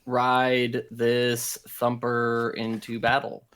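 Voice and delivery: ordinary delivery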